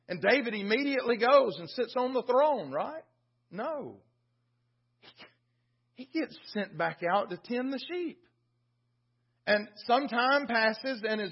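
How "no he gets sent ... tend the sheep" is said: usual speaking voice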